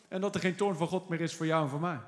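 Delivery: normal talking voice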